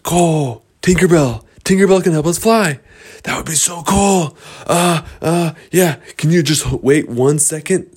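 high, loud, eager outburst; excited. Acted. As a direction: burst out with a thrilling idea. Boys excited about flying.

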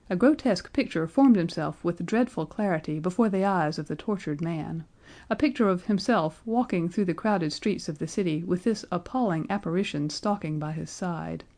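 usual speaking voice

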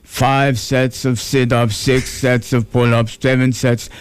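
Austrian-accented bodybuilder impression, measured and deliberate; Arnold. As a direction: count off the workout like an Austrian strongman. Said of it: "he said to me".

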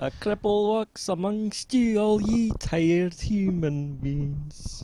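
put-on slow high sung-along lyric in Scottish accent. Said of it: "sing along yourself in a Scottish accent".

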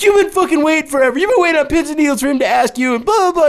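high, loud mocking girlfriend voice; teasing girlfriend. Imitating the girlfriend teasing him in the bar, high mocking voice. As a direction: clown on him like his girlfriend did. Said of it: "straight clowning on him".

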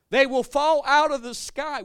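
rising into a shout, slow; loud slow preaching. Real, not acted. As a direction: hammer it home slowly. Preaching climax, repeated louder.

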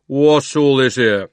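slow, loud constable's demand; london policeman. A narrator's reading. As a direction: plod through it with authority. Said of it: "said the most important policeman".

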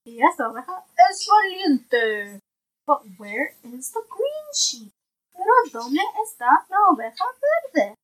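bright, clear picture-book reading; reading to a toddler. Acted. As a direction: read it aloud to a little one. Parent reads picture book aloud.

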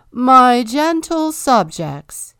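slow, high, loud royal proclamation; king ceremoniously greeting his subjects; grand address. A narrator's reading. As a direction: announce it like a pompous king. King addresses crowd again.

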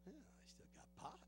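sheepish whisper. Retold or imitated. Preacher admitting to God he still smokes pot, whispered. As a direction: confess it under your breath. Comic confession in retold dialogue.

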